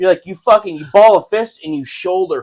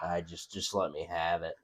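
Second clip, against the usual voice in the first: low, very soft swooning plea; playfully hushed, hungry longing; mock craving; 8 semitones lower, 17 dB quieter than usual. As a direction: beg for it in a hushed voice. Playful craving for candy ropes.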